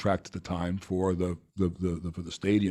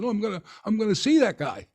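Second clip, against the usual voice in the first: much higher, louder insistence; hammed-up determined vow; mock defiant; 12 semitones higher, 4 dB louder than usual. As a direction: declare it with stubborn gusto. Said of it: "I said".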